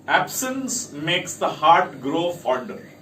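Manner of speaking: ordinary talk, nothing marked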